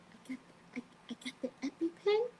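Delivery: put-on high, soft little-kid wonder; mock five-year-old, high and hushed; childlike delight. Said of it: "I'm like a five-year-old".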